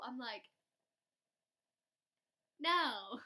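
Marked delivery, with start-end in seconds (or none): 2.6-3.2 blunt no. Pretend. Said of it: "I didn't say that".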